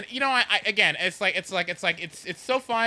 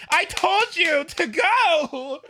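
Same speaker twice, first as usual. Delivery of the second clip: high, slow, exasperated yell; 12 semitones higher, 4 dB louder, much slower than usual. Retold or imitated. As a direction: drag it out, fed up with him. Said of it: "And it's just like".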